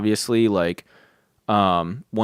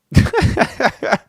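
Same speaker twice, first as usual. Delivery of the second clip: high breathy unvoiced laugh; real airy laughter; 14 semitones higher than usual. Real, not acted. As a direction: let out a breathy silent giggle.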